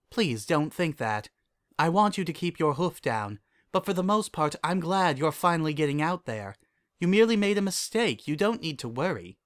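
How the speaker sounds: caring royal mentor; Celestia, reassuring mentor. Acted. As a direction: gently reassure a worried student, pitch lifted. Voices Celestia in dialogue.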